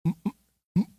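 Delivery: stumbling high over the words as if they won't come out; sarcastic stutter on mother of God; mock stammer. Shown as a demonstration. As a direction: act like the phrase sticks in your mouth. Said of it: "Does that even fit in your mouth?".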